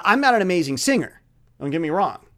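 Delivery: normal talking voice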